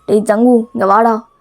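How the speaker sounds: low, deepened scolding voice; acted low-pitched angry father; stern husband, angry. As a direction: lecture the wife in a deep voice.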